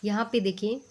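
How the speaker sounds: ordinary delivery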